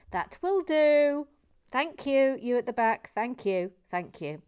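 loud, high mock telling-off; calling to hecklers. Host silences those at the back, loud mock scolding. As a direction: call it out to the back of the room.

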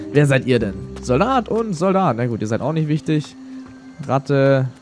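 usual speaking voice

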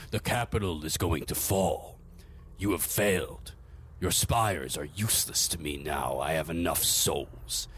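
low, cold and measured condemnation; the dark lord done with his servant; low villain voice. Acted. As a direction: master dismissing Fausti in a low cold voice. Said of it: "the lower voice".

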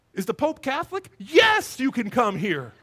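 high, loud burst of delighted agreement; elated yes. Acted. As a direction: shout the yes like it's obvious. Acting out his delighted answer.

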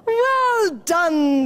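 loud, high, drawn-out cheer. A narrator's reading. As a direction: cheer the hero on. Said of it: "they cheered".

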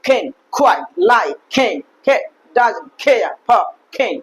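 isolated words, strong puff on each k in can; word-list drill, each can falling and breathy; aspirated k demo. Shown as a demonstration. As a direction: punch can, quite, care with airy k. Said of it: "এরকম".

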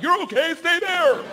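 high, loud shout; yelled with pitch way up; mock barked order. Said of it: "it doesn't sound like".

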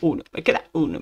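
ordinary delivery

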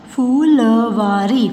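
Phulwari slow, every syllable held. Shown as a demonstration. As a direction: sound out Phulwari in pieces. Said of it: "sound pronounce करके देख लीजिए". (फुलवारी को तोड़-तोड़ कर, धीरे)

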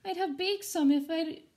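put-on lively rhyming read-aloud for kids; storybook reading. A narrator's reading. Teacher reading a rhyming ghost story to her students, animated. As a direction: read it to the class with bounce and drama.

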